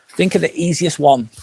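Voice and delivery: usual speaking voice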